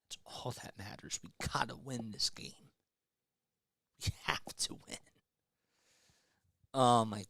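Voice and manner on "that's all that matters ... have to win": whisper. Real, not acted. Tense fan muttering to himself.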